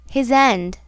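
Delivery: usual speaking voice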